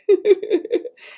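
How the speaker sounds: high-pitched tittering laugh; a little bird's giggle; squeaky chickadee laugh. Acted.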